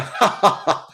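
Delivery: high loud burst of real laughter. Real, not acted. Laughing at Héctor's comment on the stream, loud real laugh. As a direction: crack up for real.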